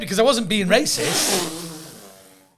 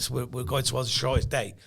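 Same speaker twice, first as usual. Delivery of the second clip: usual speaking voice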